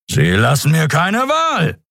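slow, high, forceful threat; menacing declaration. A narrator's reading. Captain gives up on warning the ship, menacing declaration. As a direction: rise into a drawn-out threat.

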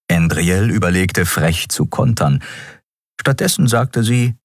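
usual speaking voice

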